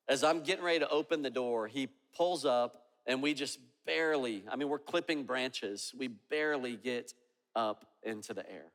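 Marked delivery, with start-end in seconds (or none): none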